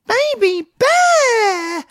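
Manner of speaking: put-on very high, slow squealing toddler cry; toddler shout. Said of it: "Harry just goes".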